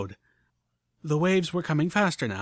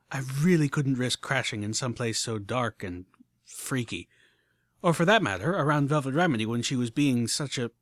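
usual speaking voice

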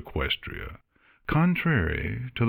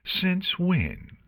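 high-pitched sarcastic challenge; sarcastic drawl; 12 semitones higher than usual. A narrator's reading. Artemis to Luna on her coffee claim, sarcastic. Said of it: "said in a sarcastic drawl".

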